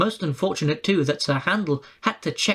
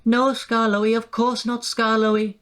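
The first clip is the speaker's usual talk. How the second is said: meek quivering; 5 semitones higher than usual. A narrator's reading. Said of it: "quivered the coaches meekly".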